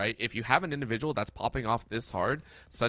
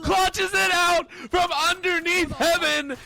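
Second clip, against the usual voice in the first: rising into a shouted hype call; excited play-by-play; 10 semitones higher, 5 dB louder than usual. Real, not acted. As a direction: build up to a roaring clutch call. Caster hype on clutch play.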